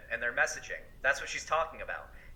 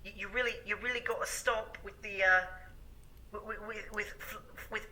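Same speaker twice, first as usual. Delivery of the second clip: mock a preachy, condescending advice-giver, pitched higher and hushed; Sargon impression; 5 semitones higher than usual. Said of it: "Sargon was spamming Shoe on Head's messages".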